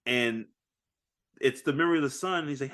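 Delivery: ordinary talk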